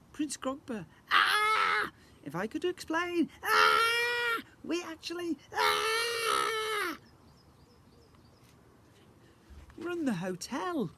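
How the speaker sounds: high, loud cries cut by screams; flustered shouting. Acted. Mum tries to explain over the prince's screams, shouting.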